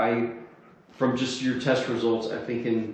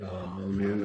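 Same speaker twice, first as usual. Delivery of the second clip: usual speaking voice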